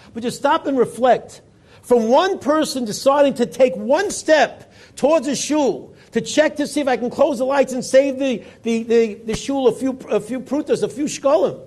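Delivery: loud, high preacher's call to reflect; sermon building to full force; preaching fervor. Acted. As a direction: rouse the congregation. Preacher urges listeners to reflect.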